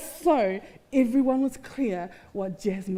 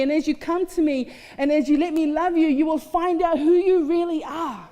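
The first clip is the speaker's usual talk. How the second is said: rising, louder pleading preach; preaching love's call; 4 semitones higher, 4 dB louder than usual. Acted. Preacher voicing love calling the listeners out, fervent and raised. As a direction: call them home with fervour.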